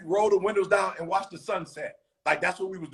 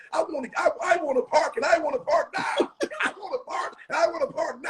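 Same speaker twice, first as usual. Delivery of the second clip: pressing a point to the listeners, pitch rising higher; insistent; 7 semitones higher than usual. Real, not acted. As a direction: insist on parking now, voice climbing. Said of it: "insisting on parking".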